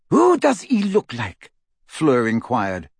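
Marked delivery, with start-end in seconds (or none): 0.1-1.5 high, put-on character voice; Fleur's eager question. A narrator's reading. Fleur asking about the newborn, high and eager. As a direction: pitch it right up, eagerly curious.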